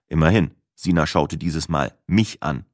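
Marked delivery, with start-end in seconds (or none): none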